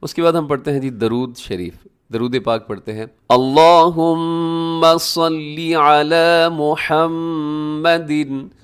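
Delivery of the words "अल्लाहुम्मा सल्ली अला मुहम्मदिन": slow chanted recitation, pitched higher; measured, melodic Arabic chant; darood recitation. Shown as a demonstration. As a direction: draw out long vowels and hum the doubled m. Teacher recites darood to demonstrate.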